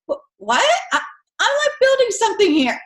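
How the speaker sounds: put-on high, whiny little boy protest; interrupted kid. Johnny protesting mid fort-building, high whiny kid voice. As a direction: play Johnny indignant at being interrupted. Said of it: "And he's like".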